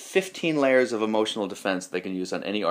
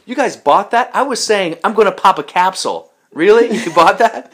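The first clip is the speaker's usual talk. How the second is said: loud, high imitation of a gleeful prankster; raised-voice retold ribbing; incredulous mockery; 11 semitones higher, 9 dB louder than usual. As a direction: crow in disbelief at who fell for it. Prankster mocks the fooled class.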